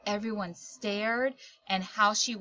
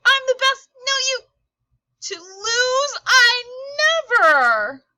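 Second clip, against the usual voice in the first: high, loud, whiny meltdown shout; tantrum; 11 semitones higher, 8 dB louder than usual. Acted. Ella melting down at Raya, loud whiny tantrum. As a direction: scream it like a kid who lost the game. Ella's tantrum over the catch.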